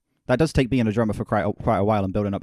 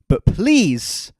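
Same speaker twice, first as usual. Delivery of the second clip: raised, slowed pleading; pleading rant; 6 semitones higher, 7 dB louder, slower than usual. Real, not acted. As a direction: beg the listener to see sense.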